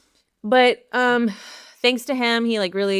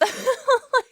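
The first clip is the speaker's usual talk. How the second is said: short high laugh. Real, not acted. Laughs at herself.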